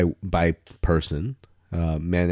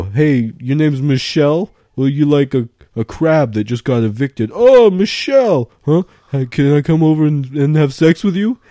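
louder, higher cheesy guy voice with a bad pun; mock pickup guy; 8 semitones higher, 6 dB louder than usual. Hitting on Michelle with a pun, loud cheesy bro voice. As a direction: ham up a cheesy frat-boy pickup line. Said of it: "what hookup culture means to me is like".

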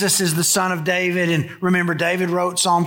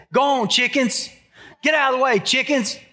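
higher-pitched mock shooing of chickens; 5 semitones higher than usual. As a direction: shoo the hens away in short, raised-pitch bursts. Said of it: "That's how I talk to the chickens".

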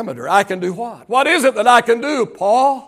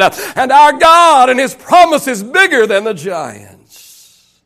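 full-force preaching; 5 semitones higher, 5 dB louder than usual. Acted. Preacher retelling the faithful spies' answer to the congregation, full-force preaching. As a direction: preach it with rising fervour.

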